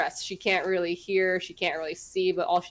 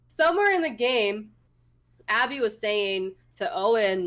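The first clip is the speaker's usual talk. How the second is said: ordinary delivery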